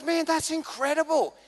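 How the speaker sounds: high-pitched imitation of amazement; blown away. Mate praising the friend who covered for him, blown away. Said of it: "He was blown away".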